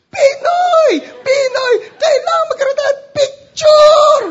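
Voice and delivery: very high, loud fangirl shriek; imitated fan shouting. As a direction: scream your demand, stretching the final word. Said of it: "So she was shouting".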